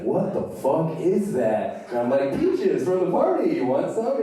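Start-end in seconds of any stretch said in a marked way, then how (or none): 0.0-1.9 slow, higher, stretched-out shock; alarmed disbelief. Acted. Freaked-out friend reacts to noise. 2.4-4.2 high, louder, carefree offer; blithe offer. Acted. Teenager offering peaches to his freaked-out friend, blithe offer.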